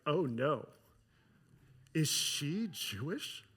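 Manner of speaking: a hint of a cornered man realising; dawning dread. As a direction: let the fear creep in quietly. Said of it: "being like".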